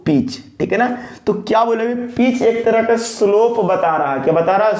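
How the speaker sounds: normal talking voice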